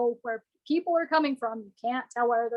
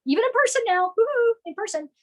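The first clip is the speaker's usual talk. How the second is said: excited cheer; 8 semitones higher, faster than usual. Real, not acted. Cheering return of in-person meetings.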